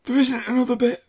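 high, loud joke sign-off; comic high aside. Pretend. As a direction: squeak it out.

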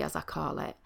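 usual speaking voice

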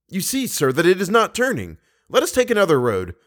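high-pitched, agitated insistence; impatient urging. Acted. Young man urges the Persian to try another road, impatient. Raoul urges giving up the mirror.

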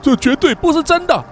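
desperate shout. Acted. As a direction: yell it to convince himself. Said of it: "于是大喊了一声".

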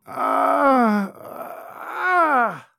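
high, drawn-out groans of pain; pained moaning. Acted. Innkeeper moans to sway the noblewoman. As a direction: moan as if dying.

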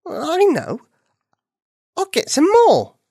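high, bright sudden idea; excited. Acted. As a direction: pop up with the idea. Bunny has an idea.

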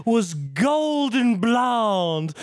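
put-on high, slow, drawn-out Southern accent quoting the film's line. Host reads the film's line aloud for his cohosts in a high, slow Southern accent. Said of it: "[name], your Southern accent is".

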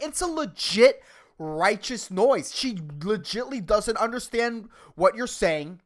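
ordinary talk

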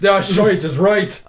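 put-on dwarf agreement; mock dwarf voice. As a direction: draw out the dwarf-style curse. Echoes the dwarf's accented curse.

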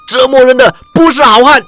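折磨人的不是好汉 shouted high and loud, rising on 不; defiant roar. Acted. Prisoner yells 折磨人的不是好汉 at the swordsman. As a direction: throw 折磨人的不是好汉 back as a proud yell. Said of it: "喝道".

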